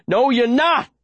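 high, fierce insistent yell; shouting at her. A narrator's reading. Boy at the face of the fading girl, shouting. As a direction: shout to keep her conscious. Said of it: "I shout at her face".

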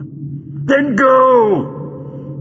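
high, strained command; high-pitched villainous yell; villain's shout. Acted. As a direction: shout it as a dismissal. Villain shouts back at Doctor.

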